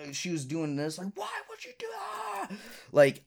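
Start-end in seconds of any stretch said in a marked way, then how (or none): 1.2-2.5 very high screechy mock scream, held back in volume; imitated screaming. Girl freaking out at him mid-argument, imitated screaming. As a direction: squeal it out like someone losing it. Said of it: "screaming, jumping up and down like this".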